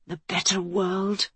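slow, hushed whisper. A narrator's reading. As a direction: whisper it softly and slowly to yourself. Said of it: "she whispered".

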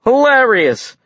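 loud, high, stretched exclamation; put-on hilarity. A narrator's reading. Alex volunteering to join the act, put-on hilarity. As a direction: oversell the joke. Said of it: "tried to get into the act".

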